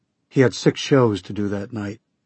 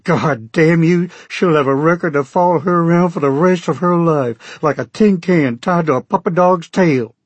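furious shout; 7 semitones higher, 5 dB louder than usual. Acted. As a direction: yell it like a father out for blood. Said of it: "he shouted".

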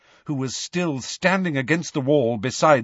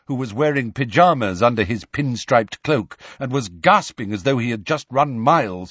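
ordinary delivery, nothing marked

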